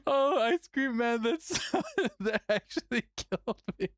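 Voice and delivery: words broken by real laughter; cracking up while talking; laughing hard. Real, not acted. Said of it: "Oh, I'm actually crying".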